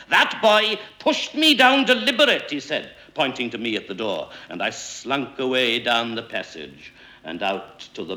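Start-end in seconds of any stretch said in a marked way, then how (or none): 0.1-2.5 high, loud, outraged complaint; indignant hissing accusation. A narrator's reading. Mister Weasley accuses the boy, high and indignant. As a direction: accuse like an angry gander. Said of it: "hissing like a gander".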